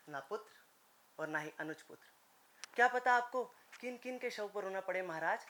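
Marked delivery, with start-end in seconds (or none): 0.1-2.1 low and much softer, solemn; grave, hushed warning. Acted. Envoy warning the king he will lose his sons, grave and quiet. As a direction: drop to a quiet, ominous low.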